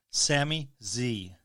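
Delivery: usual speaking voice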